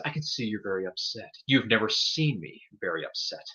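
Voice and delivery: a hint of a soft, menacing villain; low, quiet and coolly threatening; villain line. Podcaster quoting a villain's threat to the hero, lightly menacing. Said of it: "And he says".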